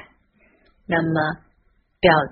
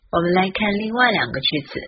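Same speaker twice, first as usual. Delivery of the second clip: normal talking voice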